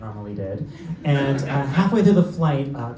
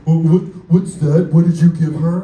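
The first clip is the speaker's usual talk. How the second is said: much louder suspicious demand; indignant passenger; 3 semitones higher, 10 dB louder than usual. Retold or imitated. Suspicious passenger demanding to know, much louder. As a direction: demand it like a rude man. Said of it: "and he's like".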